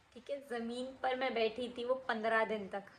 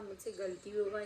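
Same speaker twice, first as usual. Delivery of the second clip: "मुझसे गलती हो गई" said quieter, begging; softer pleading; 3 semitones lower, 7 dB quieter than usual. Retold or imitated. Schoolgirl to the angry mother, fading softer plea. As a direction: let the repeat sink softer, almost giving up. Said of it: "इतना गिड़गिड़ा रही थी".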